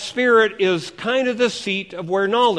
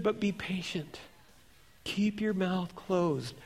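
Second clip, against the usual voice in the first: softened, patient advice; calming counsel; 9 dB quieter than usual. Acted. Inner voice calming a defensive believer, softly. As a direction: settle down into soft, measured counsel.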